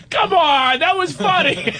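high, squealed appeal after a laughing fit; pleading for agreement, still cracking up; high-pitched amused insistence; laughing protest. Real, not acted.

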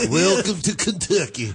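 exaggerated Southern welcome, Kentucky accent; broad Kentucky accent. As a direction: sing out the sign in a thick Kentucky twang. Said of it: "It has an accent, apparently".

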